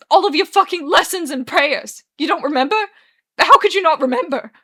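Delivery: high, loud, incredulous shouting; furious accusation. Acted. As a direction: hurl the accusation, building to a shout. Arthur's furious accusation in quarrel.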